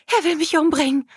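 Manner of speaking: high-pitched terrified plea; panicked gasp. A narrator's reading. Katrina tells the stranger of the threat to her life, panicked gasp. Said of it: "keuchte Katrina".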